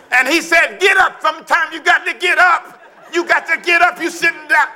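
high, loud, rousing mock preaching; hammed-up improvising preacher. Friend preaching from a random verse, hammed-up loud preaching. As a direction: whoop it up like a preacher winging a sermon. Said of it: "I just opened the Bible, wherever it fall".

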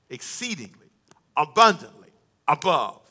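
"Exceedingly, abundantly, above" in separate beats, "abundantly" punched loud; emphatic stress. Shown as a demonstration. Preacher showing the congregation the Greek emphasis, emphatic. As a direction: break up "Exceedingly, abundantly, above", leaning hard on each word. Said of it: "the emphasis is emphatic".

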